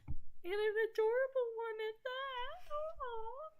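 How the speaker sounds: high, cooing over something adorable; gushing. Real, not acted. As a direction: coo like you've seen a kitten. Gushing over the fat cat.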